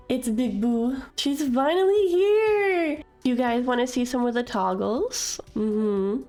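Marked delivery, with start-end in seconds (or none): 0.1-3.0 high, loud, slow cry of joy; excited shout. Real, not acted. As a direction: cry it out with delight. Big model reveal, crying out.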